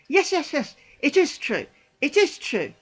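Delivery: loud, high insistence; insistent retort. Retold or imitated. Answering imagined Christians' denial, loud and insistent. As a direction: hammer it home against the denial.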